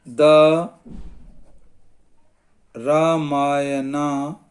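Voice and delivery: drawn-out words with long pauses; slow dictation. Shown as a demonstration. Teacher writing an underline example for students, slow dictation. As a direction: dictate very slowly, stretching each word.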